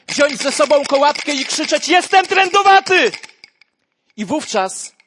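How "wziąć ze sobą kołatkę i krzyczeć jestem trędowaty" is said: a desperate, much higher shout; loud cry. Acted. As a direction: shout it out like a leper warning the crowd. Preacher shouts leper's cry. (krzyk trędowatego, głośno i wysoko)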